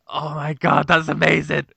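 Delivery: high, giddy mock amazement; delighted reaction. Retelling his reaction to Rob's news, delighted amazement. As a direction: exclaim it high, tickled by the news.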